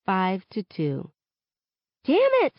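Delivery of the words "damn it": high, loud frustrated yell. A narrator's reading. Haley yelling at her teammate after a miss, loud. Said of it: "Haley yells".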